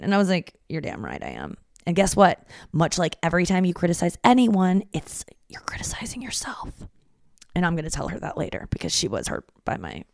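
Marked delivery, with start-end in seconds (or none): 0.7-1.6 mock menacing. Mock answer to mom. 4.9-6.7 whispered aside. Real, not acted. Aside to listeners.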